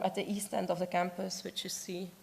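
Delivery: ordinary delivery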